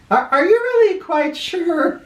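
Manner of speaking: high, doubtful wife's question. Acted. Wife doubting her husband's answer, high and unsure. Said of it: "Nellie said".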